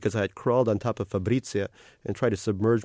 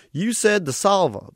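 much higher, louder cartoon mascot greeting; cartoon otter greeting a new arrival, all sugary cheer; carnival otter voice; 10 semitones higher, 6 dB louder than usual. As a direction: gush like a cute carnival mascot.

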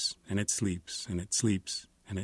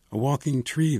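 raised, delighted exclamation; excited crowd; 6 semitones higher, 6 dB louder than usual. A narrator's reading. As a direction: call it out in wonder. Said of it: "they all say".

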